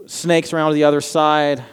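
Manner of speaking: ordinary talk, nothing marked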